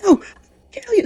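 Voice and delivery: sleep-talker's threat climbing into a scream; mock screaming threat. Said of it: "screaming, "I'll kill you".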